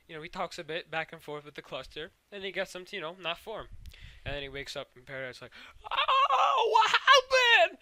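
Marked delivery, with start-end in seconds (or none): 5.9-7.8 very high, loud, stretched-out cry; hammed-up screech of alarm; mock panicked shriek. Voices Peridot's shock at waking.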